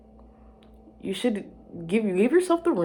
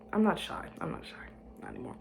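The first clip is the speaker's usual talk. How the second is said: sigh, then whisper, softer each time; fading whisper; 3 semitones higher, 8 dB quieter than usual. Real, not acted. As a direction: fade to nothing. Fading whispered self-assurance.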